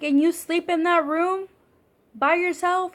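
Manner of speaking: high, loud, disbelieving question; can't believe he sleeps there alone; shocked disbelief. Retold or imitated. Disbelieving reply to dad's story.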